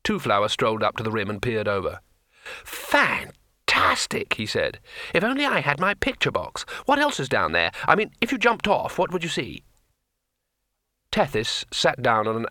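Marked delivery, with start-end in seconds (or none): 2.7-4.3 high, long-drawn exclamation of wonder; thrilled tourist. A narrator's reading. Tourist peering over the edge, thrilled. 5.1-9.6 high-pitched, eager wonder; thrilled tourist. A narrator's reading. Tourist asking what lies below, excitedly curious.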